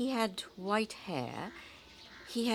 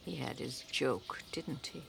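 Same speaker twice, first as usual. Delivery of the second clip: low, soft, hurt; bitter disappointment; 8 semitones lower, 9 dB quieter than usual. Acted. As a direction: quietly admit being fooled. Aunt deceived, softly.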